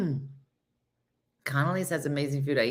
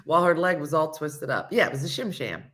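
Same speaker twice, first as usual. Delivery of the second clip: ordinary talk, nothing marked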